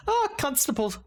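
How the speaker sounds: a jolly Irish greeting, quick at the end; a stage Irish accent, lightly done. Retold or imitated. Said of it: "why the constable's Irish now".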